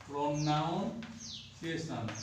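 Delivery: drawn-out, soft, syllable by syllable; slow dictation. Real, not acted. Teacher dictating a rule for pupils to write, slow dictation. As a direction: stretch the word out quietly for note-takers.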